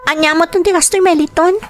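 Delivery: high, sharp scolding demand; angry demand. Acted. Mistress scolds Meliton for withholding money, angry.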